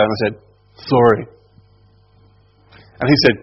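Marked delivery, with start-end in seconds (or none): 0.9-1.2 short, grudging mock apology; insincere apology. Child made to apologise to his brother, grudging insincerity. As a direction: toss it out without meaning it. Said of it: "Were we really sorry? No, we weren't".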